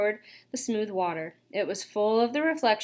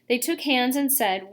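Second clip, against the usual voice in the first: ordinary delivery, nothing marked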